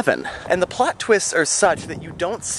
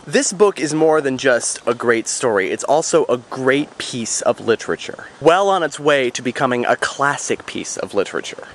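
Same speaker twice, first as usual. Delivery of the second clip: ordinary talk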